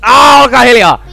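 loud, high yell for silence; exasperated shout. Real, not acted. Player telling chattering characters to shut up, exasperated yell. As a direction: shout them down.

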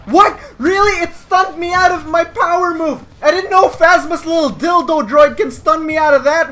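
high, loud disbelief; incredulous outrage. Real, not acted. Player stunned out of his move, incredulous shouting.

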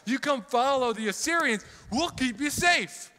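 a herald's loud mocking threat. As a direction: jeer at the people over the walls. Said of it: "speaking in the Hebrew language to the people".

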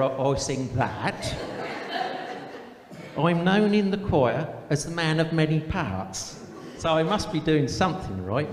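put-on broad country accent, letter read aloud; put-on rural accent, comic storytelling. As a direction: read it as a rustic cousin with a rural English accent. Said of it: "best read in a rural accent".

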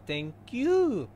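put-on squeaky anime girl thanks; cute girl voice. Shown as a demonstration.

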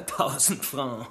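slow, laughing through the words; scornful laugh. Acted. Suspect laughs off the inspector's thousand francs claim.

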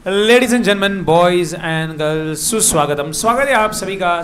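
loud, drawn-out emcee greeting; showman's welcome. Pretend. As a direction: announce it grandly like a show host. Opens lecture with showman's welcome. (मंच संचालक जैसा ज़ोरदार स्वागत)

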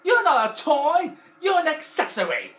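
high, loud, slow shouted insults; Mister Potato Head voice. Retold or imitated. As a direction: yell at him with scorn. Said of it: "he went like this".

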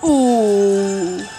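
ordinary delivery

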